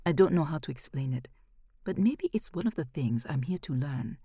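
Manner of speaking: ordinary delivery, nothing marked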